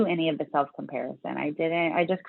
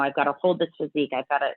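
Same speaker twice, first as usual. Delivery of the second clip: ordinary talk, nothing marked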